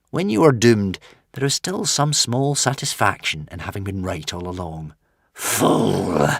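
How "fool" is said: snarled. A narrator's reading. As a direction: spit the word with contempt. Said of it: "snarled the dragon".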